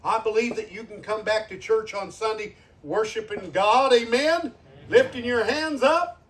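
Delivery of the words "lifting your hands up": normal talking voice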